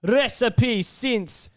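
slow, hammed-up Scottish accent; a bad Scottish ad voice, drawn out; mock Scottish accent. Hosts hyping the Scottish drink in a mock Scottish accent. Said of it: "we're butchering the accent right now".

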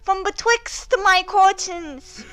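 put-on high cutesy little boy voice; squeaky baby-talk kid; uwu voice. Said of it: "do this in an uwu voice".

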